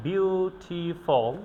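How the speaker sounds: "Beautiful" slowly in three separate syllables; broken into syllables. Shown as a demonstration. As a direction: break "beautiful" into বিউ, টি, ফুল. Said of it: "এই শব্দটিকে আপনি তিনবার ভেঙেছেন". ("Beautiful" তিন ভাগে ভেঙে ধীরে)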